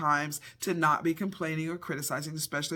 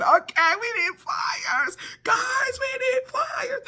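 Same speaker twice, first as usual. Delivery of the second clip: frantic shout; slower than usual. Retold or imitated. Calling the kids for pliers in a flood, frantic shout. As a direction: cry out for help, stretched and desperate.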